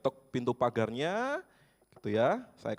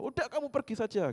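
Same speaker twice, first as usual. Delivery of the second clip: high-pitched grandma brushing him off; imitating a cranky grandma; dismissive old woman; 12 semitones higher than usual. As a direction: wave the pastor away as a cranky grandma. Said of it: "semakin keras nadanya".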